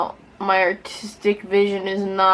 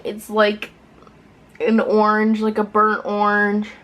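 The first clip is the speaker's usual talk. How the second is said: usual speaking voice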